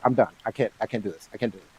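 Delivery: very soft, low, giving up; retold defeated mutter. Fiance to himself walking through the door, defeated mutter. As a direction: mutter it under the breath, as if done.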